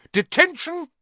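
high, loud furious yell; shouting. A narrator's reading. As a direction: bark it like a stern teacher. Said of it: "she shouted".

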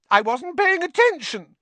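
very high, loud theatrical wail; dramatic. A narrator's reading. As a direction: declare it like a wounded drama queen. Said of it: "said Myrtle dramatically".

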